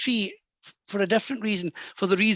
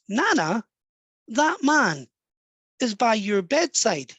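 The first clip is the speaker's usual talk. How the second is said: slow, raised child voice, imitated; little boy; 3 semitones higher, slower than usual. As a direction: act an amazed child telling his nana. Little boy tells his nana.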